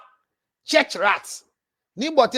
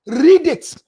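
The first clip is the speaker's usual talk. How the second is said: 1 loud command; 4 semitones higher, 6 dB louder than usual. Real, not acted. Challenging the viewers to read.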